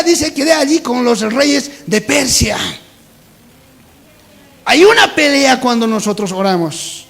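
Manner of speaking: loud, high, declaimed scripture; prédica a plena voz. Real, not acted. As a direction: proclaim it forcefully to the congregation. Preaching at full force. (predicando fuerte, con voz elevada)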